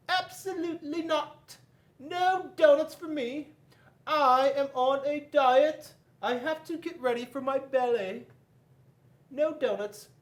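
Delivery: a ballerina on a diet refusing; shrill-free high prim dancer voice; haughty ostrich. Acted.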